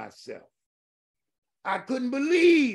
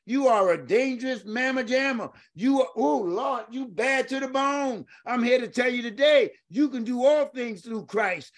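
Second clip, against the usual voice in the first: rising preacher fervor; 5 semitones higher than usual. Real, not acted. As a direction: lift the church with each line. Preaching at full force.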